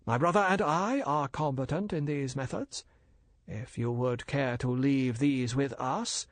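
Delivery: polite and bland, a dealer explaining; bland Chinese merchant. Acted. Older Fu brother lecturing the major, smooth and polite.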